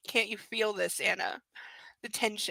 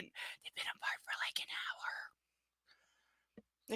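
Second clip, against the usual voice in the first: whispered sarcastic aside, much softer; 12 dB quieter, faster than usual. Real, not acted. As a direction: lean in and whisper the joke.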